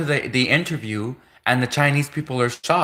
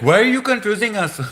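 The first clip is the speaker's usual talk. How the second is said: much higher, louder put-on whine; mock exasperation; baffled interviewer; 8 semitones higher, 4 dB louder, faster than usual. Interviewer confused by the multilingual applicant, high loud mock whine. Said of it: "he's like".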